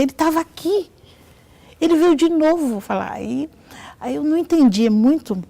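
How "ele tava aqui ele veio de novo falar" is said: high-pitched imitated astonishment; retold excited exclamation; amazed. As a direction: report a wonder, pitch lifted high. Doctor's amazed quote in retelling.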